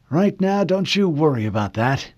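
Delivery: high, brushing-off admiral; old admiral voice. Acted. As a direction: wave the question away. Admiral deflects.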